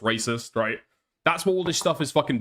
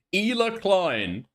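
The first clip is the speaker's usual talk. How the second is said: incredulous; 5 semitones higher, much slower than usual. Real, not acted. Incredulous at the claim.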